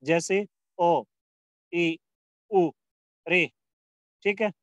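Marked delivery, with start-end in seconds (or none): 0.0-3.5 each short vowel spoken slowly, one by one; हर्ष स्वर, छोटे; short vowels, clearly sounded. Shown as a demonstration. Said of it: "कम समय लगे उन्हें हर्ष स्वर".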